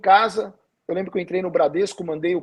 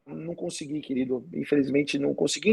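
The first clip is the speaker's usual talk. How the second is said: much softer, resigned answer; quiet sad reply; 2 semitones lower, 8 dB quieter than usual. Retold or imitated. Pastor tells his friend on the phone, much softer and resigned. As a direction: let it drop to almost nothing.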